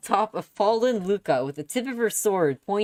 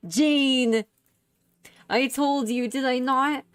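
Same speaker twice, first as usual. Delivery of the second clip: excited; 7 semitones higher, slower than usual. Acted. Princess greets victorious knight.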